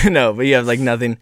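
ordinary delivery

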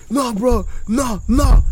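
heated retold protest; pissed-off friend. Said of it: "Richard's still fucking pissed".

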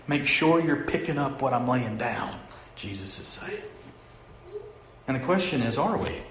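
usual speaking voice

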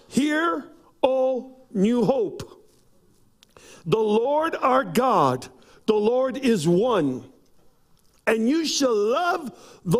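slow, high emphatic sermon reading; preaching scripture. Acted. Pastor reads the commandment to New Hope, slow and preaching. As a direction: draw each phrase out like a preacher.

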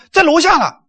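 high, airy claim of the latecomer; late friend on phone. Retold or imitated. Busy brother tells waiting friends he has arrived, lightly mocked assurance. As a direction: lightly mimic his high-pitched excuse. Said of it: "他要是说".

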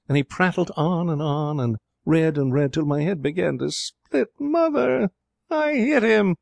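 building to a very high-pitched wail; exasperated outburst. A narrator's reading.

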